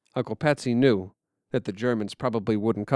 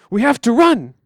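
loud, high angry yell; 9 semitones higher, 7 dB louder than usual. Acted. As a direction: snap at your little brother to shut up. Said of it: "he yells".

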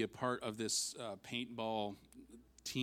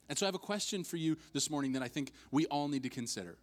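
ordinary talk, nothing marked

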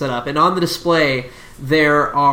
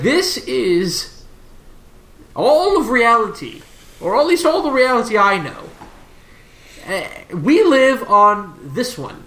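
higher-pitched professor showing off a device; 5 semitones higher than usual. Acted. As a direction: present it grandly as a lecturing professor. GM voices professor showing his device.

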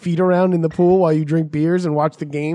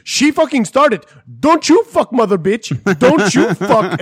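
put-on loud, high Indian-accented yelling; hammed-up Indian-accented shouting; Indian accent impression; 5 semitones higher, 6 dB louder, slower than usual. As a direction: imitate an irate driver with an Indian accent. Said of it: "best Apu impression I've ever".

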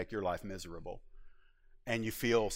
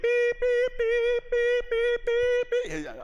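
high steady bleep tones covering curses; censor bleeps; 6 dB louder, much slower than usual. Shown as a demonstration. Preacher bleeping out a man's cursing, high beep. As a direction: bleep out the swearing. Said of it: "I'm just beeping him out there".

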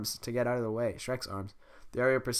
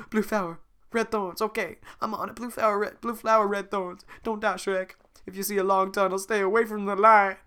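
high, eager talking donkey; 9 semitones higher, 6 dB louder than usual. Acted. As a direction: repeat it like a frantic errand. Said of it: "most genuine donkey impression".

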